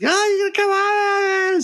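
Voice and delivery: high-pitched hysterical wail on the phone; mock a panicked, wailing phone call; hysterical wife. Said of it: "she's hysterical".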